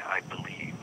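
ordinary delivery

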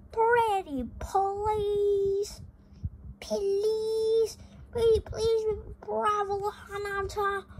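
high, drawn-out pleading; begging kids. Acted. Nephews beg Uncle Geronimo to go, high and whiny. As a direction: whine please like eager children.

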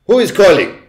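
high, loud witch snap with a put-on accent; snapped. A narrator's reading. Witch demanding who is calling, snapping loudly. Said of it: "snapped the Grand High Witch".

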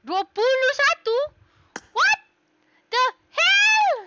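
loud, high disbelieving shout; shocked outburst. Real, not acted. Streamer shocked by twenty-one, loud high disbelief. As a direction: climb to a high, loud shriek, word by word.

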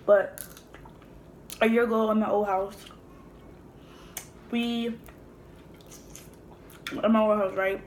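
chewing while talking; talking between bites; mouth full. Real, not acted. Seen 0: puts crab meat in mouth, then talks with mouth moving.

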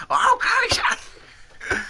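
high squeak thinning out to breath; tiny high mouse impression; squeaky mouse voice. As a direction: echo the mocking mouse squeak. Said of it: "Squeaky mouse voice".

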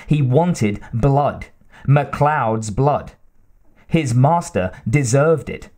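usual speaking voice

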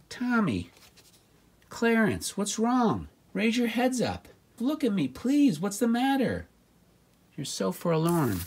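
put-on high, gentle coaxing, like talking to a sad child; coaxing sad players. Soothing the forlorn Falcons, coaxing them high and gentle. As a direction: sweetly urge the players to lift their heads.

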